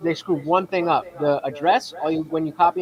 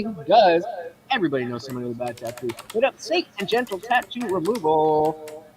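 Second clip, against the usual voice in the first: ad read; 7 semitones higher than usual. Acted. Reads a sponsor ad.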